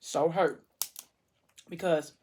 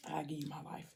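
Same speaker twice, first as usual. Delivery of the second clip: whisper; 18 dB quieter, faster than usual. Acted. He offers his life to her.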